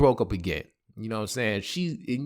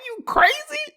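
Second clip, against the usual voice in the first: very high-pitched comic squawk; incredulous friend; 4 dB louder than usual. Retold or imitated. Friend hearing he turned a girl down, mock outrage. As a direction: imitate a buddy in hammed-up disbelief.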